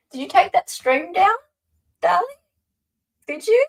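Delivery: high, rising, pestering question; teasing call-out. Real, not acted. Calling out to Darley about his stream, high and teasing. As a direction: needle him with a high sing-song.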